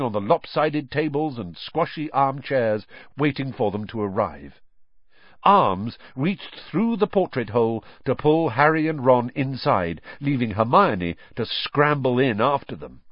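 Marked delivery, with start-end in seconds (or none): none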